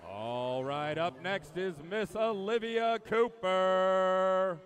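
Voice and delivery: slow arena-style name call; announcer's introduction. Acted. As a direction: build up to a long, held-out name. Announcer introduces next swimmer.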